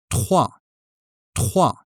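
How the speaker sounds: trois said crisply, repeated; careful trois. Shown as a demonstration. Teacher drilling learners on trois. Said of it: "This one is a nasal sound".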